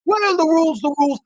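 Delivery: high, emphatic questioning; retold indignant demand. Challenging the mask enforcer, indignant high retelling.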